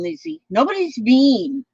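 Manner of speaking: ordinary delivery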